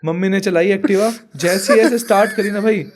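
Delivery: ordinary talk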